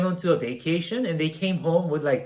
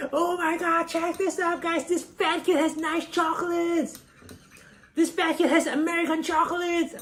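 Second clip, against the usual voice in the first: much higher, mocking schoolgirl voice; mocking popular girl; 13 semitones higher than usual. Popular girl mocks the narrator to classmates, much higher and shrill-free. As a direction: squeal it like a snooty classmate showing off. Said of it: "And she's like".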